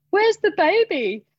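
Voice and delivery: high, loud, slow panicked question; sudden alarm. Retold or imitated. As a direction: realise in a flash the baby is gone. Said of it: "looked around and went".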